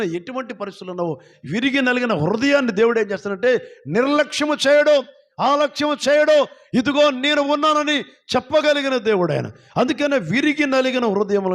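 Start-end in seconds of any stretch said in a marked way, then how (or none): 1.5-11.5 high-pitched, insistent sermon delivery; impassioned preaching. Real, not acted. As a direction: rise to a ringing cry of assurance. Preaches with rising force. (ఆవేశంగా ప్రసంగిస్తూ)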